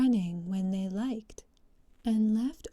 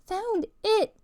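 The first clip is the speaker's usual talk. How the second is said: high, louder, irritated mouse snap; rather cross; 11 semitones higher, 6 dB louder than usual. Acted. Mouse snapping back at the Duck, cross and louder. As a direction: correct the Duck with testy impatience. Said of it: "replied rather crossly".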